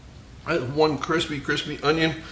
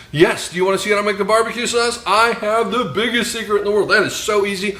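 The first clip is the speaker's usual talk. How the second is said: excited, higher-pitched salesman pitch; used car salesman; 6 semitones higher than usual. Pretend. Host hypes his barbecue sauce secret to viewers, used car salesman voice. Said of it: "I got my used car salesman voice on".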